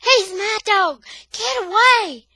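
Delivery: high, loud desperate shout; boy yelling over his dressed-up dog. Acted. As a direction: scream it at her to let go. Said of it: "I'm yelling".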